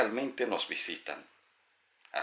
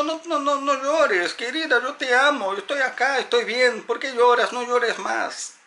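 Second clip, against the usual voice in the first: much higher, louder, begging them to stop crying; desperate pleading; 13 semitones higher, 6 dB louder than usual. Acted. Desperate spirit to its crying loved one, higher pleading plea. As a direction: plead with a loved one not to cry, slowing at the end. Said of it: "el espíritu entra en desesperación, diciendo".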